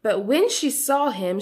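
ordinary delivery

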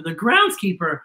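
plain reading voice

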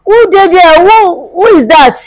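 loud, high call. Acted. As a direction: shout out to find who is there. Calls out to unseen person.

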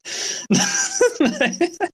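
high giggle that climbs; a short high amused laugh; high rising laugh. Real, not acted. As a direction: laugh at your own story. Laughs after his quote.

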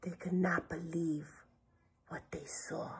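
very soft, whispery narration; hushed storybook awe; hushed suspense. A narrator's reading. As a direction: drop to a near whisper for the reveal. Suspense before the reveal.

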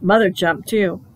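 usual speaking voice

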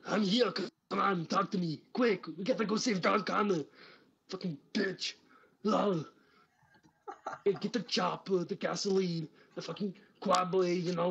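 high, loud hammed-up Austrian accent; Arnold Schwarzenegger. Doing his Arnold impression for a stranger, hammed-up Austrian accent. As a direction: bark it like a cartoon Arnold. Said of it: "also do Arnold Schwarzenegger".

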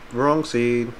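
usual speaking voice

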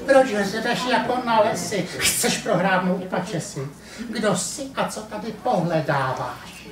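a know-it-all farm cat sizing up the puppy; hint of a haughty cat; cat Emma. Cat Emma voiced in story.

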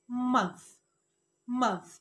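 Month said cleanly as a single syllable; correct pronunciation. Shown as a demonstration. Teacher shows viewers how to say Month. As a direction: model Month clearly. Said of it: "هقول إيه؟".

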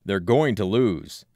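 loud, slow declaration; a slow shout held partly in check; understated shout. A narrator's reading. Stocky man to the crowd, understated slow shout. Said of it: "the man shouts".

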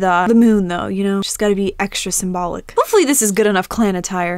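ordinary delivery

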